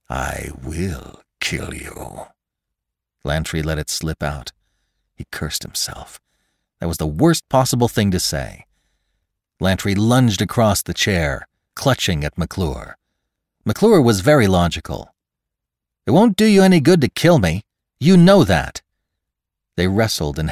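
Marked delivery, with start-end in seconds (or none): 0.1-2.3 low, soft, very slow menace; menacing threat. Acted. Killer lets his threat slip out, menacing. Lantry threatens to kill McClure. 16.1-18.8 high, loud, fast protest; urgent reasoning. Acted. Victim argues with the man lunging at him, urgent. McClure argues while under attack.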